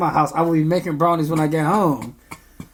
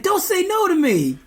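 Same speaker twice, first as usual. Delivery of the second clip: 1 mimicking kid; 14 semitones higher than usual. Kid quote, high put-on voice.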